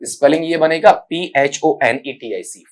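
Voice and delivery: ordinary delivery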